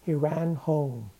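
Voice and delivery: ordinary delivery, nothing marked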